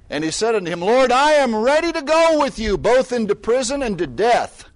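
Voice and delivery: high, loud preaching read; booming preached scripture. Acted. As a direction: proclaim the verse with force. Reading scripture with force.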